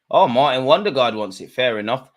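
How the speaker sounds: ordinary delivery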